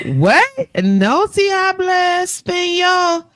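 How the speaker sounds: high, slow, faux-innocent Spanish; mock playing dumb. Teacher joking about dodging deportation, high and slow, playing dumb.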